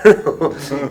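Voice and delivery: short real chuckle; brief amused laugh. Real, not acted. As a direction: laugh at your own story. Laughs at own fight story.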